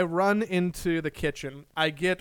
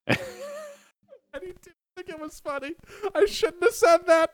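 giggly regret; 12 semitones higher than usual. Real, not acted. Laughing regret after bad joke.

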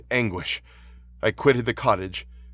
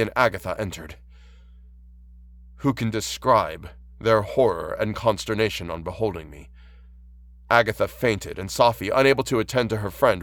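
ordinary delivery

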